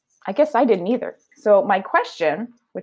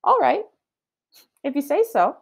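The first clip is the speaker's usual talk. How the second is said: mild surprise; 9 semitones higher than usual. Acted. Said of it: "looked surprised".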